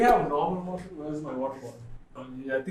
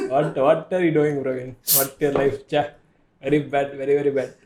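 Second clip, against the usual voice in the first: put-on typical Indian accent. Guest jokingly scolds Praveen, typical Indian accent. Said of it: "I just spoke like a typical Indian".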